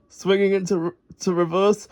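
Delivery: ordinary delivery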